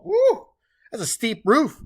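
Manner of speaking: a high, loud whoop of delight at the steep roof; excited woo. Real, not acted. Teacher reacting to a steep roof pitch, excited whoop. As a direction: burst out with a real yelp of surprise.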